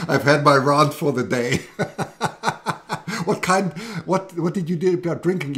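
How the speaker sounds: giggling through the words; laughing. Real, not acted. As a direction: laugh it off after the rant. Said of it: "Sorry, now I've had my rant".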